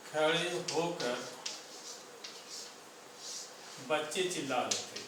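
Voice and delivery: slow, word by word, long pauses between words; slow dictation. Shown as a demonstration. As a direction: dictate it so students can write along. (धीरे-धीरे शब्द-शब्द लिखवाना)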